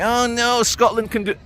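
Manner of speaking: high, quick parody of a politician; mock SNP voice. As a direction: sneer it out like a smug party line. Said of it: "and the S N P".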